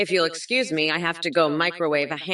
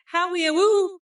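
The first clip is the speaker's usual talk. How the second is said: playful whoop; 10 semitones higher than usual. Pretend. Candidate punctuating a silly ten-step plan, high whoop. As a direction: whoop it out high between points.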